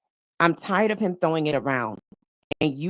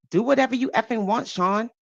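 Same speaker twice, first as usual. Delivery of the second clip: higher, fast exasperated outburst; 7 semitones higher than usual. A narrator's reading. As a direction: fling it at him, fed up. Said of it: "I sighed".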